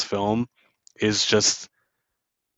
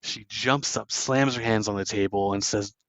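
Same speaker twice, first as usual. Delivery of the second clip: ordinary talk, nothing marked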